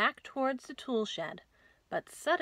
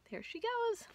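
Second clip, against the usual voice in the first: playful high voice; 13 semitones higher, 4 dB quieter than usual. Real, not acted. Playful remark after rhyme.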